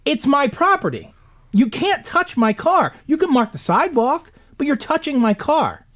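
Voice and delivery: high and slow, spelling it out; slow indignant lecture. Retold or imitated. Scolding the tire-marking guy, slow and high. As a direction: lay down the law word by word.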